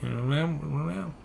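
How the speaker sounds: very soft, muffled mumbling; mock counter-lady murmur, words smeared together; mimicked unintelligible mumble. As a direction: imitate someone mumbling behind a mask. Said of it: "just going".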